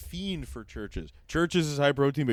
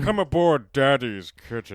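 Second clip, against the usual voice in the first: lightly voiced sleazy boat owner, a touch louder; sleazy invitation; 2 semitones higher, 6 dB louder than usual.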